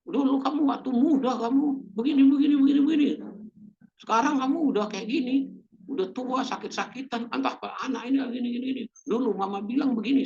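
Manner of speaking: high-pitched, exasperated nagging; harsh scolding. Retold or imitated. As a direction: lose your temper and snap at them. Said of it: "Malah kasar kata-katanya". (omelan kasar bernada tinggi)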